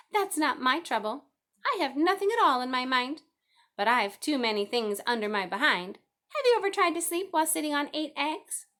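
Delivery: high, loud, fussy goose voice, repeating herself; a busy mother goose clucking the time; chattering goose. A narrator's reading.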